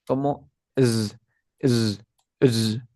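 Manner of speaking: drawn-out voiced zzz; short repeated bursts of a humming z; buzzing Z sound. Shown as a demonstration. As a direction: buzz each z on its own. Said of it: "quiero que pronuncies de la siguiente forma".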